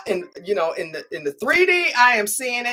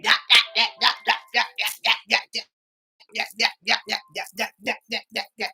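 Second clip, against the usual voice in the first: mock running her mouth; faster than usual. Imitating an irate woman lashing out at a man, mock yapping. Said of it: "running her mouth".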